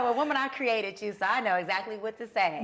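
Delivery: God answering her, loud and knowing; God's voice. Retold or imitated. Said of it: "He's like".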